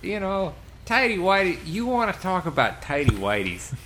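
put-on thick New York accent, lightly done. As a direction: tough Staten Island guy, mild accent. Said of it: "I'm just talking in a New York accent now".

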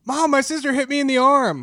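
high-pitched mock child complaint; tattling child. Child tells mom on sister, mock high-pitched whine. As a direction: pretend to tattle like an aggrieved kid.

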